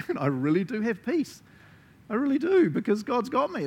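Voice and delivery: high, bright declaration of peace; joyful confidence. Pretend. A calm believer insisting God has them, high and glad. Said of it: "I'm like".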